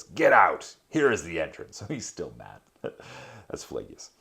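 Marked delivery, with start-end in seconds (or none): 0.0-1.8 an angry ferryman yelling; loud shouts. A narrator's reading. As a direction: bark it out loud at the travellers. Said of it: "with sh-, loud shouts".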